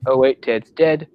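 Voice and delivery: laid-back, polite New Zealand accent impression; calm, friendly rock guy, unbothered by anything; Korg. Explaining the rules to a gladiator, laid-back. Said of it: "really laid back, polite demeanor".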